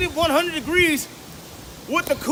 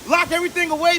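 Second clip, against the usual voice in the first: usual speaking voice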